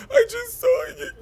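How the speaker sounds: very high wailing fake sob; mock a fake crier's wail; mock fake crying. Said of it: "I hate when bitches be crying".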